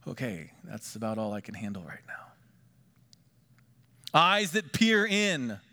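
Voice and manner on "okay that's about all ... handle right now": whisper. Retold or imitated. Overwhelmed under Jesus' gaze.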